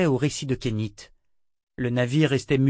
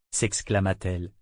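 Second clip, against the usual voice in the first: ordinary delivery, nothing marked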